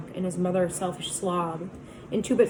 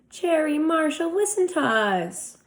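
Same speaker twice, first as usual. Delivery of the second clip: slow, higher coaxing plea; smooth Soc; 9 semitones higher, 4 dB louder, slower than usual. A narrator's reading. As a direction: sweet-talk the girls. Soc voiced by narrator.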